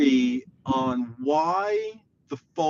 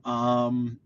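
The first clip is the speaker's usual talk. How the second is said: ordinary delivery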